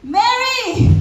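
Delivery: high, loud call of a name. Acted. Jesus calls Mary by name, calling out. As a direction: call her name out, pitch leaping up.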